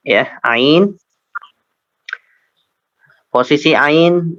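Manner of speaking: ordinary delivery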